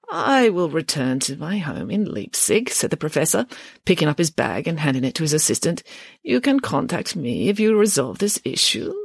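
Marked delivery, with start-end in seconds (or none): 0.1-2.7 put-on slow, quavering old man's voice; unhurried elderly scholar; elderly professor. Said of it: "spoke slowly and with a quavering voice distinctive of the elderly". 6.2-8.9 put-on slow, higher, quavering old voice; wavering elderly delivery; elderly professor. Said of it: "spoke slowly and with a quavering voice distinctive of the elderly".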